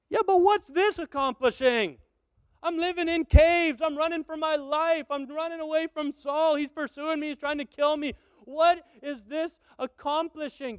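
complaining David. Acted.

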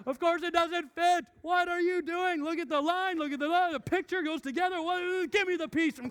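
high, loud exasperated yelling; exasperated dad. Retold or imitated. Dad yelling at son over the puzzle, fed up. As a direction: snap like a fed-up father.

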